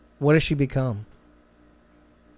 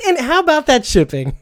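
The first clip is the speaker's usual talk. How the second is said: laughing; 12 semitones higher, 6 dB louder than usual. Real, not acted. Laughing about shipping costs.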